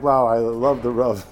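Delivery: imitating a scolded man's sarcastic thanks. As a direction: say it dripping with irony. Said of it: "it didn't sound like".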